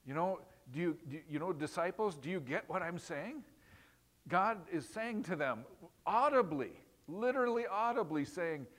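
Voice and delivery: normal talking voice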